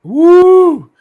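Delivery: loud, high, drawn-out woo; celebratory whoop. Real, not acted. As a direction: whoop it up for the win. Celebrating his team's win.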